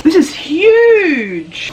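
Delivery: high, long-drawn exclamation; real wide-eyed amazement, very slow on huge; amazed. Real, not acted. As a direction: stretch HUGE out in high-pitched astonishment. Amazed at the oversized hoodie.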